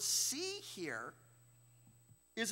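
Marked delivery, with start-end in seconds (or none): 0.0-0.6 "see" lifted much higher; stressing the word. Shown as a demonstration. Teaching the class the Greek word, with "see" lifted high. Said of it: "'cause the word".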